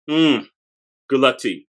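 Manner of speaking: ordinary delivery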